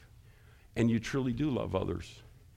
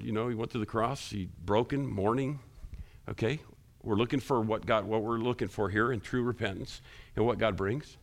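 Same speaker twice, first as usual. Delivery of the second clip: ordinary talk, nothing marked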